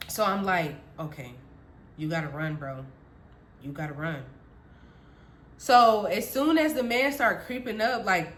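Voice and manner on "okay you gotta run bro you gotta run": low, very soft pep talk; hushed, low resolve; quiet self-talk. Retold or imitated. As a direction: mutter it to yourself. Retold self-talk before running.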